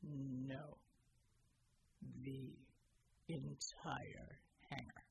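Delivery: very low, slow, heavy; low grim soldier. A narrator's reading. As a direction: drag out entire hangar.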